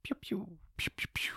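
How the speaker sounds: soft whispered laser pews; breathy toy-gun pew sounds. Pretend. Spooky sound effects whispered.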